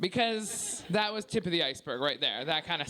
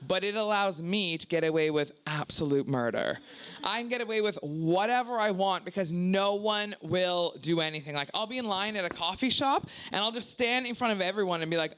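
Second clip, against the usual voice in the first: usual speaking voice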